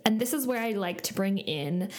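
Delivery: normal talking voice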